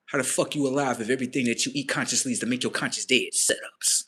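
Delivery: ordinary delivery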